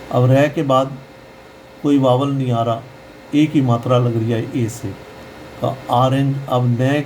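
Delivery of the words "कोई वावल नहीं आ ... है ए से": usual speaking voice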